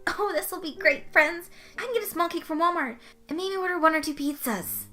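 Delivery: high, bubbly party planner; delighted Butters. Acted. Butters planning cake and pizza for friends, bubbly and high. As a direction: rattle off the list with glee.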